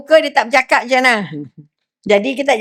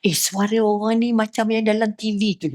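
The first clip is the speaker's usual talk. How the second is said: retold whisper; 3 semitones lower, 6 dB quieter than usual. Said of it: "bisik kat anak dia".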